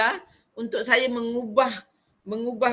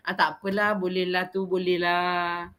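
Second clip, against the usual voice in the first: lax teacher; 4 semitones lower than usual. Retold or imitated. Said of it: "Dia tak ada macam".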